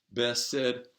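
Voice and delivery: ordinary delivery, nothing marked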